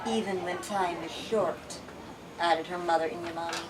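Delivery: steady read-aloud narrator voice; story narration. A narrator's reading. Reading a novel aloud to the group, story narration. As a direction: tell the tale to your listeners.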